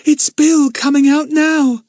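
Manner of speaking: put-on high, loud, drawn-out girlish cry; excited cry. A narrator's reading. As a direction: call out the news in high excitement. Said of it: "cried Lucy Ann in excitement".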